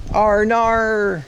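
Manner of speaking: high drawn-out call of the name Arnar. Real, not acted. Streamer calling a friend by name, high drawn-out shout. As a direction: call out to get Arnar's attention.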